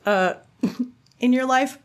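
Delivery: high laugh through the question. Real, not acted. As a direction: giggle the words out. Laughing at her own question.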